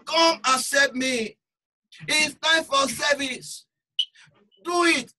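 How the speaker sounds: loud, high, slow altar call; preaching call. Acted. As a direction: rouse them to answer now. Preacher calling to action.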